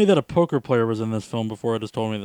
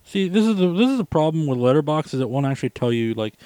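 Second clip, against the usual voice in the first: ordinary talk, nothing marked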